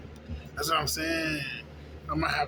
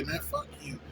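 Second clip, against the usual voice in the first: breathy private retort; 9 dB quieter than usual. Retold or imitated. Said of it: "That's all you really wanna say".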